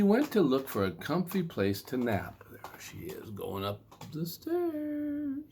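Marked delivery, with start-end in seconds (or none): 2.5-5.4 soft, slow whisper to the listener; hushed, much higher aside. A narrator's reading. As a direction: point her out in a hush.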